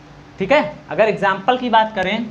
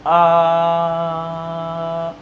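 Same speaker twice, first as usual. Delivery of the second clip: drawn-out vowel; 5 semitones lower than usual. Shown as a demonstration. Teacher drawing out the vowel for students, slow. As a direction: hold the aa sound long and slow. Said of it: "दीर्घ उच्चारण मतलब देर तक उच्चारित करें".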